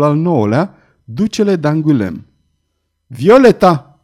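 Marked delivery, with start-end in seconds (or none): 3.1-4.0 loud, higher-pitched snap of a name; angry snap. A narrator's reading. Belgoder rebukes the young girl, angry snap. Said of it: "se răsti Belgoder".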